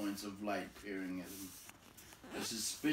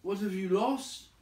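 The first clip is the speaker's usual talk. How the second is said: calling out; 11 semitones higher, 7 dB louder than usual. Acted. Bilbo calls across the dark.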